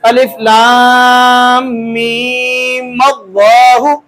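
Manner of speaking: very slow chant, "मीम" flowing into "अल्लाहु" as "मल्लाहु"; one-breath elongated "अलिफ लाम मीम अल्लाहु", linked by m; joined recitation. Shown as a demonstration. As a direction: hold "लाम" and "मीम" long without breaking. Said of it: "मीम को लाम के साथ मिलाना".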